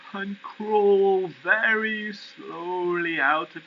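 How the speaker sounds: high, drawn-out slow-motion narration. A narrator's reading. Narrator telling of a tortoise waking and crawling out, very slowly. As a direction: stretch the words out like a creeping crawl.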